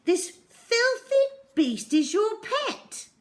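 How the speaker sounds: very high, loud and slow in disgust; disgusted accusation. A narrator's reading. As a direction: spit out the accusation. Teacher accusing Zoe.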